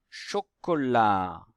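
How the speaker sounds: CHOCOLAT very slow, syllables stretched; teacher's slow CHOCOLAT with a long ch; sounding out. Shown as a demonstration. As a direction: draw out CHOCOLAT for careful listening. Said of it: "Si vous entendez le son".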